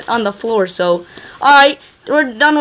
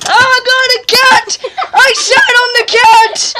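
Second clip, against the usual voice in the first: silly shout; 10 semitones higher, 3 dB louder than usual. Real, not acted.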